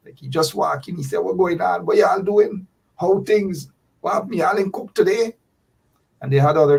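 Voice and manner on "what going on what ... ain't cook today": higher-pitched Guyanese Creolese greeting, imitating the relative. Relative walks into his father's house, talking Guyanese Creolese. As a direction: breeze in chatting in Guyanese Creolese. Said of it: "he started talk like he from Evesham".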